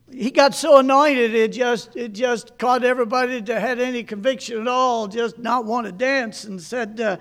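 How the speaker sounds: put-on reading an old sermon aloud to a congregation. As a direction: read it as a preacher retelling his own story.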